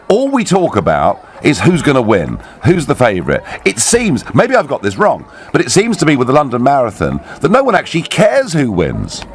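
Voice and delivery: normal talking voice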